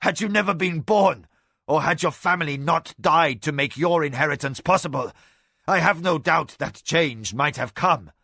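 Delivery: a proud enemy's furious scorn with a bitter laugh; raging defeated lord. Acted. Tasayo rails at the assembly.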